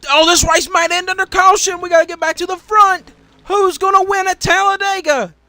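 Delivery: high, loud play-by-play; urgent shouted call as the lead changes; excited race call. Real, not acted. Commentator calling the run to the front under threat of caution, excited.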